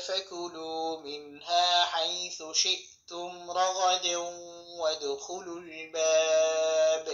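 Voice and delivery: slow drawn-out tilawah chant; Quran recitation. Acted. Teacher recites the whole verse for students, slow chant. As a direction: recite the verse slowly, stretching the vowels.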